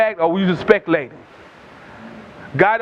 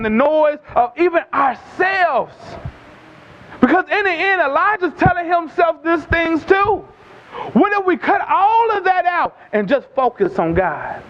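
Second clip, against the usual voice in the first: raised, high, drawn-out exhortation; full-force preaching; 7 semitones higher than usual. Real, not acted. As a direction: call out to the whole congregation.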